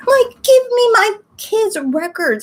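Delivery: high, slow, fed-up plea; exasperated demand. Retold or imitated. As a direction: drag it out, fed up with the clinic.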